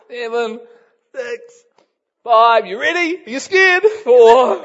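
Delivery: high, very loud taunting count; shouted countdown. Retold or imitated. Kids taunting the riders, a shouted high countdown. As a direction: yell the numbers to scare him.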